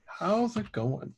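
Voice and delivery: slow, high put-on greeting; drawn-out goofy hello; a hint of a cartoon voice; mock greeting.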